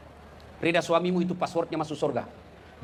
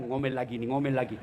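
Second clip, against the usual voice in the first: low, softer dread of nagging; imagined grumbling; 8 semitones lower, 5 dB quieter than usual. Acted. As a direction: grumble it low, as a husband thinking to himself. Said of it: "tiba-tiba dia bayangkan". (gumaman suami, rendah dan pelan)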